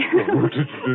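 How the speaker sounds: put-on short girlish giggle over the champagne; a quick delighted giggle. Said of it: "Don't, don't, don't giggle".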